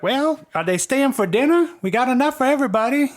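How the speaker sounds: high, fast grandma voice; old lady. Acted. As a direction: welcome them eagerly like a grandma. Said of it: "the old lady's like".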